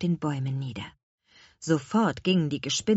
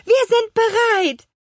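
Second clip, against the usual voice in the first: very high, loud moth cry; calling out; 7 dB louder than usual. A narrator's reading. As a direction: squeal that you're all ready. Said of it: "riefen die Motten". (mit hoher Stimme laut gerufen)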